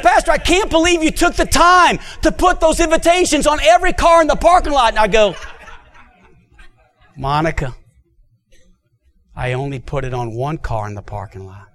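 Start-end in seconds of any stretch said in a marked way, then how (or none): 0.0-4.9 amazed woman voice. Retold or imitated. 7.2-11.7 quiet reveal. Retold or imitated. Pastor reveals gently.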